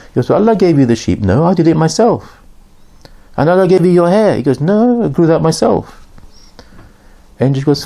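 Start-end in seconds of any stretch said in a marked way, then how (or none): 0.3-2.4 higher-pitched mock protest; lively storyteller's back-and-forth, pitched up; retold dispute. Retold argument over the sheep. 3.4-4.4 pitched-up insistence; storyteller acting an accuser, high and lively; retold challenge. Retold argument over the hair. 4.6-6.1 high-pitched mock protest; lightly acted stubborn refusal; retold denial.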